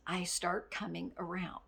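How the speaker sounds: normal talking voice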